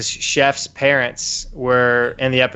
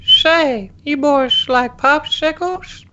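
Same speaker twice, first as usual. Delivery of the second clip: put-on much higher, creepy old man voice; Herbert the Pervert; 12 semitones higher than usual. Herbert luring the neighbourhood boys to his cellar, in a much higher creepy old voice. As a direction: lure the boys in with a creaky old voice. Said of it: "Old Man Herbert the Pervert".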